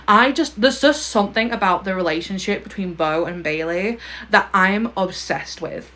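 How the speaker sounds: ordinary talk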